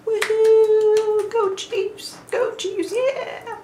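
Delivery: very high, bright whoops for the team; high cheering. Real, not acted. A fan cheering her Chiefs' win, high and excited. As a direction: cheer like the game just ended.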